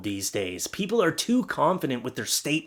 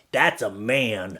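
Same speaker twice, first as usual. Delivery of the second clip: lower, drawn-out mock verdict; mock macho approval; 4 semitones lower, much slower than usual. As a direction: stretch it out in sarcastic manly pride. Mocking macho idea of manhood.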